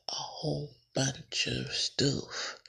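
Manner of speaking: slow, stretched "A whole bunch of stuff"; drawn-out emphasis. Real, not acted. Podcaster listing topics, repeats "A whole bunch of stuff" slowly stretched. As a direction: draw out each word of "A whole bunch of stuff".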